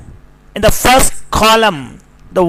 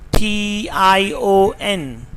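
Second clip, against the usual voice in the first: ordinary talk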